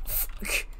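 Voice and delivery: normal talking voice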